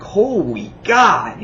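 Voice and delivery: high, drawn-out exclamation of awe; mock amazement. Reviewer reacting to the shock ending, hammed-up amazement.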